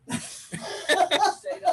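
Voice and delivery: high breathy rising laugh; amused airy laugh. Real, not acted. Laughing at the crying man.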